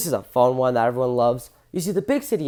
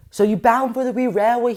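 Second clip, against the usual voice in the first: higher-pitched attempt at a Scottish accent; hammy Scots impression, pitched up; 7 semitones higher than usual. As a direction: put on your best amateur Scottish accent. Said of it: "that's my best Scottish accent".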